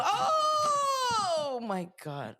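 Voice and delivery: long, high-pitched yelp of alarm; real startled cry. Real, not acted. Podcaster as restless animal knocks over light, startled cry. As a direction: cry out in shock, drawing out the "oh".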